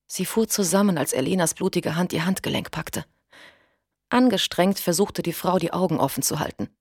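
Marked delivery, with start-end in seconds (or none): none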